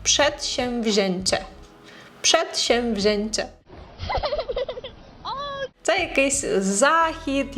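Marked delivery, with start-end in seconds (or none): 0.0-3.5 Przedsięwzięcie said slowly and clearly; slow careful repeat. Shown as a demonstration. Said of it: "разом прочитаємо". (повільно і чітко)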